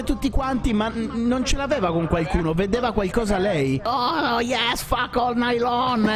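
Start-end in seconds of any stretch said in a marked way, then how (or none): 3.8-6.1 imitating a crazed woman. Imitating possessed woman on plane.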